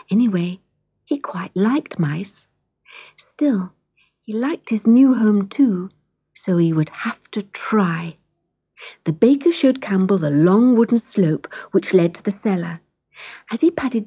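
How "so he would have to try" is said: ordinary delivery, nothing marked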